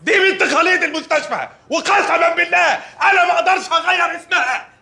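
doctor shouts at the father about the name; shouting insistence. Acted. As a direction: swear to it at the top of your voice.

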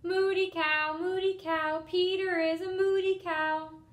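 high, loud taunting chant; little sister's loud taunt; sing-song shouting. Acted. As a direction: chant it to tease your brother. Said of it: "She started shouting".

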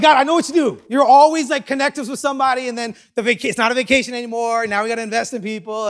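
loud, high, rapid rant to God; exasperated complaint. Retold or imitated. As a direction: rattle off a hammed-up protest, pitched high. Acted complaint to God about vacation.

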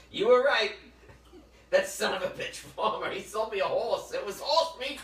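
loud, high indignant rant; retold outrage. Imitating John ringing about the farmer's swindle, loud comic outrage.

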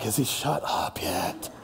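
mock weary family, slow and hushed; exhausted. Family worn out by dad's talking, exhausted. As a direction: drag it out on spent, voiceless breath. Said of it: "Everybody's just exhausted".

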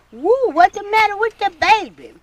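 put-on high, loud worried call over the baby; concerned old lady. Miss May fretting over the baby, high and loud. Grandmother's friend asking about baby.

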